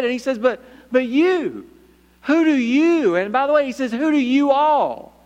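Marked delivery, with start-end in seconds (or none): 0.4-3.1 emphatic question, louder on YOU. Acted. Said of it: "in a very emphatic". 3.9-5.1 slow, emphatic WHO DO YOU ALL. Acted. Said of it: "in a very emphatic way".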